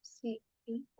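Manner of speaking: very slow drowsy repetition; slow sleepy repeating. Shown as a demonstration. Student repeating the sleepy mantra, very slow. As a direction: drag each word as if half asleep. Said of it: "अपने आप को ये बात कम से कम दस बार बोलो".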